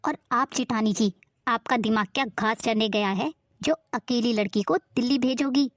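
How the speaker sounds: a suspicious, scolding aunt pitched low. Acted.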